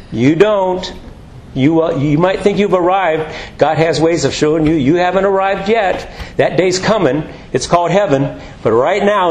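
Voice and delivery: ordinary talk, nothing marked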